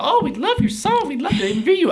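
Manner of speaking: gushing media caller. Retold or imitated. News people calling a songwriter for an interview, gushing. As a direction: gush like an eager caller, pitch way up.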